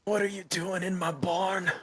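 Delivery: put-on high-pitched old farmer challenging intruders. Old farmer to strangers in his barn, high-voiced challenge. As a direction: demand it in a high old-timer voice. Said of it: "to be an old farmer".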